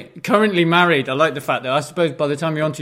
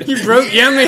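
amused laugh; 10 semitones higher, 9 dB louder than usual. Real, not acted. Friend collapses laughing.

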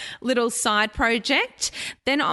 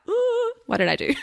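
a sudden high ooh of self-doubt, with a laugh after; mock panic. She laughs at her own doubts, a high mock panic. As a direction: play a mock panicked gasp at your own choice. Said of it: "you're like".

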